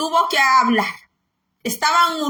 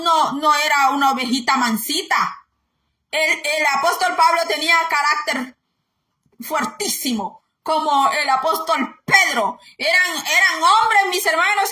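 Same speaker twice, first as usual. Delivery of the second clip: normal talking voice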